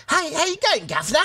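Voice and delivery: put-on high-pitched guv'nor caricature accent; put-on English accent. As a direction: call out like a high mock-English character. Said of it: "when I do that accent".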